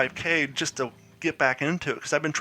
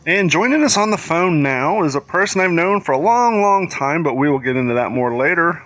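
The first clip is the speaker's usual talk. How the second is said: louder, booming show-host read of the guest intro; radio host announcer voice; 10 dB louder than usual. Acted.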